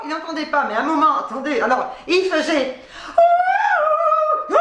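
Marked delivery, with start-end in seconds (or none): 3.1-4.5 very high sustained hooting "oh"; mock operatic vocalizing. Imitating man singing on balcony at dawn, mock high vocalizing. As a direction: imitate a crazed singer's wailing vocalises. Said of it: "il faisait des vocalises comme un dingue".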